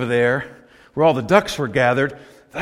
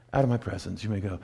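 dismissive; 8 semitones lower, 12 dB quieter, faster than usual. Shown as a demonstration. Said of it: "kinda like".

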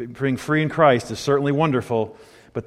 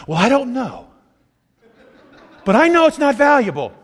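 mock judgmental critic; 8 semitones higher, 5 dB louder than usual. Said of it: "Who, who should we pick out".

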